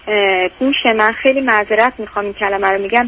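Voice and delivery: ordinary talk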